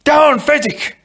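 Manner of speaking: high, loud shouted order; urgent yell. A narrator's reading. Inigo ordering the frozen Fezzik down, urgent yell. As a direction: yell the command with full urgency. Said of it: "And he yelled".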